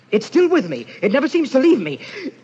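high, loud, agitated confession; agitated and haunted, pitched well up; tormented dread. Acted. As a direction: build toward horror, haunted by the urge. Obsessed husband confesses horrible urge.

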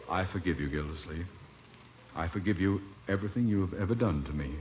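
low, hushed last farewell; solemn forgiveness. Acted. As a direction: forgive him as if these are your final words.

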